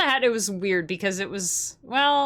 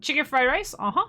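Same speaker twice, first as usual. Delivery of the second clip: order-taker imitation; 7 semitones higher than usual. Restaurant worker repeating a customer's order, high questioning imitation. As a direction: repeat the order back, lifting the end. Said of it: "they always repeat your order".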